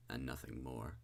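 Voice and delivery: plain reading voice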